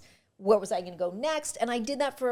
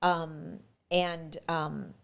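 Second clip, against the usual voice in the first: usual speaking voice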